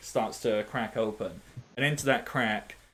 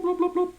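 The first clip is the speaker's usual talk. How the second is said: high squeaky bloops, way above the voice; cartoon bloop noises. Game master giving the moonbeam its silly sound, squeaky bloops. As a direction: pop out each bloop like a bubble.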